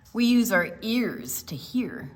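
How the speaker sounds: slow, clear picture-book reading; reading to children. Acted. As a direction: read it out to a room of kids. Reads picture book aloud.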